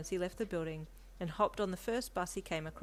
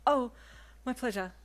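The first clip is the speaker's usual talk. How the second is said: lightly happy, with a lifted start; a touch of happy eagerness; happy reply; 6 semitones higher, 7 dB louder than usual. A narrator's reading. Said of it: "she replied happily".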